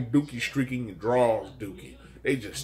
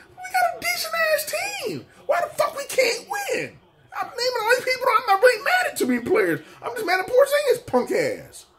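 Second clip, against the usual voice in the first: shrieking falsetto sob-yell, much higher; crying shout; 3 dB louder than usual. Acted. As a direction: wail it out like a devastated fan. Fan wailing rant over losses.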